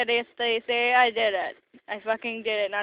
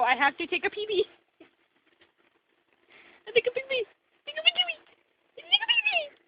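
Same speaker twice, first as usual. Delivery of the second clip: climbing squeaky whine. Pretend. Pretend cat begging to pee, climbing pitch. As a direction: pitch rising higher each repeat.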